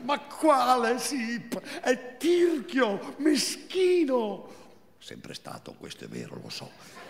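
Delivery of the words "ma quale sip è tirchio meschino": much higher, slow, scornful; Marina's thin voice. Acted. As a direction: drag out each insult high and thin. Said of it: "quella sua voce sottile e inadeguata". (voce sottile, sprezzante e lenta)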